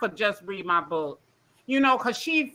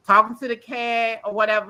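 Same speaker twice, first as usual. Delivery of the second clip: ordinary talk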